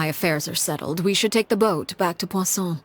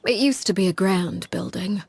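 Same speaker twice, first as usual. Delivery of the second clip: ordinary delivery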